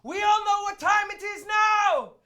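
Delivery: loud, high, hammed-up cheerful shout; overjoyed lawyer. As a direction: play the fake-happy lawyer announcing it. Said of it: "who seems to be the boss ... is like".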